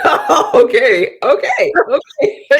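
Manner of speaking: high, slow unhinged laughing okays; crazy lady laugh. Shown as a demonstration. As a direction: laugh like you've snapped in disbelief. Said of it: "that crazy lady laugh".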